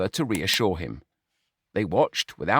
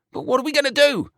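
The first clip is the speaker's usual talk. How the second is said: much higher, desperate plea; panicked wail; faster than usual. A narrator's reading. George pleading with Brian, panicked. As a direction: beg for an answer. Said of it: "George wailed".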